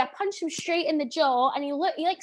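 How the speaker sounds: usual speaking voice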